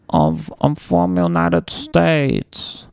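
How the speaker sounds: slow, high-pitched mock foreign accent; bad imitation of a foreign accent, long drawn out; Malady. Man showing Hallie how his ex talked, mock foreign accent. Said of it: "it's kinda like".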